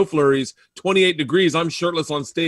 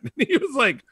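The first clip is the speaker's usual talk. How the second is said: normal talking voice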